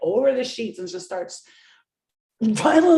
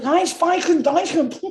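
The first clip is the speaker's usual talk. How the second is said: put-on German accent; 9 semitones higher, 6 dB louder, slower than usual. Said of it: "She was like".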